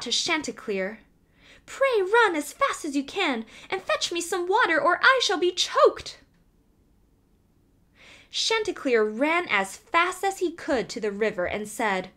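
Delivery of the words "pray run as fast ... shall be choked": high, frightened plea for help; frightened hen calling for water; frightened cry. A narrator's reading. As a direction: cry out in a panic, choking on a nut. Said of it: "Then she was in a great fright".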